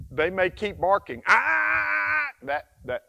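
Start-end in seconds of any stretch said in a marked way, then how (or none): 1.3-2.3 sharp high loud "Ah!" correction; corrective ah. Shown as a demonstration. Trainer correcting a barking puppy with a sharp loud ah. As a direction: bark out a sharp correction noise. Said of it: "that's the corrective vocalization".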